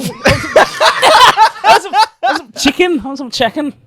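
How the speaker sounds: high, hammed-up Scouse broken by laughter; Liverpool accent. Shown as a demonstration. Demonstrating Scouse to mates, laughing Liverpool accent. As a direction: play up Scouse on the word chicken. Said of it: "That's Liverpool, isn't it?".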